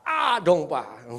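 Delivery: loud, decisive answer; firm, confident. Retold or imitated. Driver answers the preacher's question, firm and confident. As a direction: answer with total certainty, loudly. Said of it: "Dia jawab dengan mantap".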